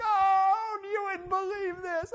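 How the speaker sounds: high, loud mock distress; upset student in the office, hammed-up. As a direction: play the student dramatically.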